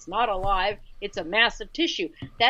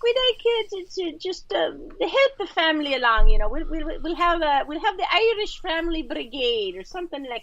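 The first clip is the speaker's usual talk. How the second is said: hammed-up Irish accent, pitched much higher; mock Irish accent; 8 semitones higher, 4 dB louder than usual. Joking about Irish names for Planned Parenthood, in a high mock Irish accent. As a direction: play a cheeky Irish campaigner in an Irish accent. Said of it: "I'd like to hear 'em say it in their, you know, their accent".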